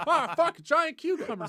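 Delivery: high, loud hammed-up alarm; mock panicked yell. Retelling a monster charging at him, high mock yell. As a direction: shriek at the oncoming monster. Reacting to giant cucumber monster.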